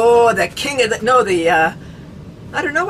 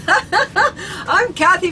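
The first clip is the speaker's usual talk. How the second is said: laughing; 8 semitones higher, much slower than usual. Real, not acted. Laughter yoga host intro.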